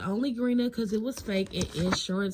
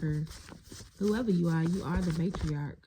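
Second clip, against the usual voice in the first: ordinary delivery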